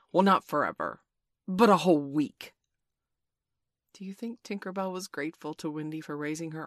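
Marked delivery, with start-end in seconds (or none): 0.1-2.5 loud, still stern concession; grudging relenting. A narrator's reading. Peter softens the banishment after Wendy raises her arm, loud and grudging. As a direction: give way a little, keeping the volume up. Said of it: "did he relent sufficiently to say".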